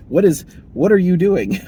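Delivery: puzzled manager challenging the worker; incredulous boss. Retold or imitated. Boss questions biking worker.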